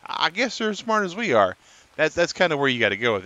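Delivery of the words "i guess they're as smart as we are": light Southern twang, a bit higher. Retold or imitated. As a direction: play the alien with a Southern lilt. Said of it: "southern twang in their voice".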